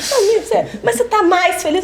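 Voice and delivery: imitando amiga, voz aguda e risonha; teasing. Retold or imitated. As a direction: pergunta insistente rindo. Amiga insistindo, em tom risonho. (amiga provocando Laura sobre felicidade)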